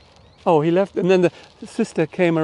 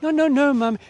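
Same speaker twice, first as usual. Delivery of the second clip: put-on high, hurried little-boy denial; higher-pitched kid protesting no to mum; child's denial; 9 semitones higher than usual. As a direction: fib like a caught child. Child denying to his mum.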